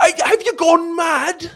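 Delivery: very high, loud mock British indignation; John Oliver. Podcaster mimicking a TV host ranting, high loud mock disbelief. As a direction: shriek it slowly in exaggerated British outrage. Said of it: "They do that".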